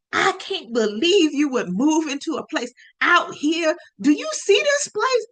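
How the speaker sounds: high, loud imitation of a ranting dad; talking loud. Dad going off at daughter over her new apartment, talking loud. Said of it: "Talking loud".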